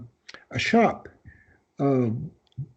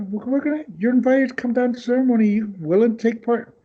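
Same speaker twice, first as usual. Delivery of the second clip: lightly voiced inviter; 8 semitones higher, faster than usual. Witch's invitation to ceremony.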